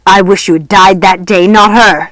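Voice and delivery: loud spitting hatred; spat. A narrator's reading. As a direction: spit every word out. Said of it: "spat the man in the mirror".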